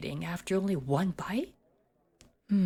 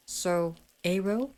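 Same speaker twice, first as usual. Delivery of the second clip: ordinary delivery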